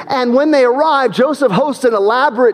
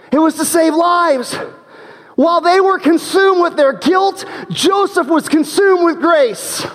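rising, emphatic preacher climax; preaching at full force; 8 semitones higher than usual. Real, not acted. Preacher driving home grace over guilt, high and emphatic. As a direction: drive the contrast home, high and strong.

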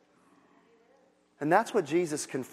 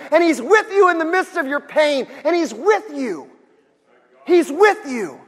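preaching at full force; 11 semitones higher, 12 dB louder than usual. Acted. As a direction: build to a shouted climax. Preaching climax.

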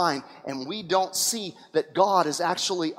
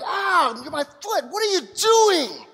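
high, slow yelp of pain and anger; pained outburst; 8 semitones higher, slower than usual. Acted. Balaam yelping at the donkey after it crushes his foot. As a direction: cry out as the leg gets crushed.

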